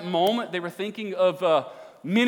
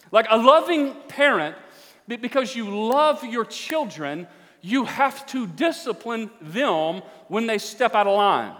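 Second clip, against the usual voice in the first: forceful preaching; 3 semitones higher, 5 dB louder than usual. Acted. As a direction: drive it home, stretching the key words.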